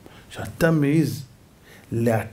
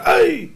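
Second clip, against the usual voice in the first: very high, loud "ow"; comic shrieked yelp; mock yelp of pain; 6 dB louder, slower than usual. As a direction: cry out like struck sand. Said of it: "הוא צועק".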